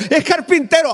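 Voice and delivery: put-on high little-kid answer; child's voice. Pastor voicing a classmate answering the teacher, high child voice. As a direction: say it like a proud small boy.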